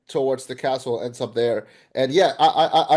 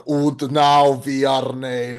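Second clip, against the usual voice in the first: put-on slow, heavy German-accented vampire line; Orlok's German accent; 5 dB louder, much slower than usual. Orlok over the signed papers, slow German accent. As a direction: lean into a thick German accent, slowly. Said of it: "one of my favorite lines in the movie is when he goes".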